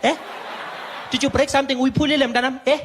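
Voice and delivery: high, loud sarcastic questioning; mocking clerk. Clerk mocking the comedian about his surgery, loud sarcasm. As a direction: needle him with each question.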